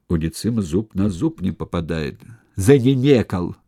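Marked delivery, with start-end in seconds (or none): 2.5-3.6 growling at a cowering soldier; growled. Acted. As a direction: snarl it at him. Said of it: "прорычал Сула".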